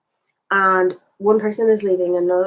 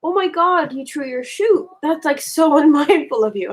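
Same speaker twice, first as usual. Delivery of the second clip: higher, mock-shocked gossip voice; mock scandalised friends; 6 semitones higher than usual. Friends scolding her for the shoe, in a lightly mocked horror. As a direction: play the appalled friends, lightly hammed. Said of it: "They were like".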